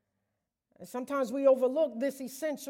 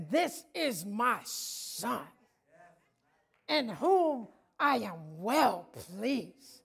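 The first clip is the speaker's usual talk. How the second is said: tender voice of the Father; 3 semitones lower, much slower than usual. Acted. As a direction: speak it like a proud whisper of love. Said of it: "quiet slow quote of Father".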